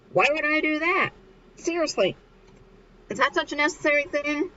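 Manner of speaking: high, put-on disbelieving voice; incredulous questioning. Mikio rejects hairstyle advice, acted high incredulity.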